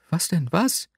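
high, impatient rising query; interrupting question. A narrator's reading. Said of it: "unterbrach sie mich". (hoch, ungeduldig unterbrechend)

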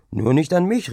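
merry call. A narrator's reading. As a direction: call it out merrily, pitch climbing. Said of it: "rief ich lustig". (lustig und laut gerufen)

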